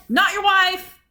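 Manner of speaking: loud, high retold snap; indignant retort. Wife retelling her retort to a staring man, indignant.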